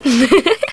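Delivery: light playful giggle. Acted. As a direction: giggle after a coy denial. Teased about thinking of boys.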